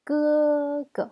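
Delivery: first "Ge" long, high and level, second "ge" short and light; neutral tone. Shown as a demonstration. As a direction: hold "Ge" high, then let "ge" fall away briefly. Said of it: "Ça se prononce léger".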